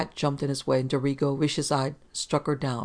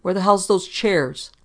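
loud, higher, drawn-out angry demand; snapping; 4 semitones higher, 7 dB louder, slower than usual. A narrator's reading. Policeman demanding the chairs from Engel, snapping. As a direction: snap it with force. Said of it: "snapped at Engel".

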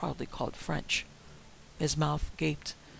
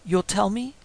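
louder, higher threatening question; gritted demand; 5 semitones higher, 8 dB louder than usual. A narrator's reading. Master pressing his servant for answers, gritted demand. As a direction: force it out through gritted teeth. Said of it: "I gritted".

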